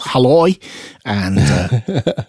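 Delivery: usual speaking voice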